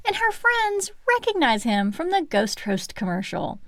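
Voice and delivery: ordinary talk, nothing marked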